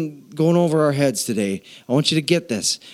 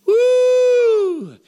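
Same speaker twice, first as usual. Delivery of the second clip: excited whoop. Real, not acted. Preacher whoops at congregation.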